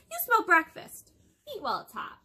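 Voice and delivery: giant's wife. Acted. Giant's wife telling her husband to eat, high and bossy.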